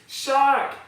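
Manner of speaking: loud, high shout of alarm; the boy bellows his warning; top of his voice. Acted. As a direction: yell it as loud as a boy can. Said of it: "at the top of his voice".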